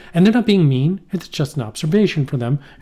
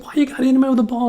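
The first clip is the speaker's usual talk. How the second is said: high, fast mock scolding; scolding mother impression; 11 semitones higher, 4 dB louder, faster than usual. Mother scolding dad over the ball, high and fast. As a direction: act a mother telling off dad. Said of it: "She's like".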